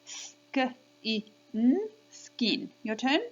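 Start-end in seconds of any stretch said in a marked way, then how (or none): 0.1-1.9 "Sk, I, n" as isolated sounds with gaps; sounding out. Shown as a demonstration. Teacher has children repeat after her, sounding out "Sk, I, n". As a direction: break "Sk, I, n" into single phonemes, short i. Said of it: "so repeat after me".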